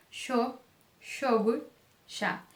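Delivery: yo, yogurt, ya beginning with "sh", as in Argentina; Rioplatense SH. Shown as a demonstration. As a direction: turn the Y of yo, yogurt, ya into "sh". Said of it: "Você pode pronunciar como sho". (Y com som de X em yo, yogurt, ya)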